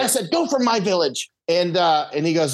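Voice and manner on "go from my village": high commanding cry; mock shout. Chief ordering border patrol out, high mock shout. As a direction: drive them out like a chief. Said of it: "Kuna are famous for shouting".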